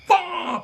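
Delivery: high mock slap "pa"; a quick high imitated slap; sharp slap sound. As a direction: snap out the slap noise like a hand striking.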